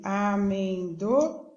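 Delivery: "Amendoim" slowly, with a long gap before "im"; syllable by syllable. Shown as a demonstration. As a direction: separate each syllable of "Amendoim". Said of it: "Você abriu a boca quatro vezes".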